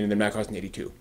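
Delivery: usual speaking voice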